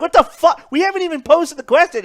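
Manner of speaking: high, loud disbelief; mock outrage. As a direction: squawk it in disbelief. Retold shocked adventurers.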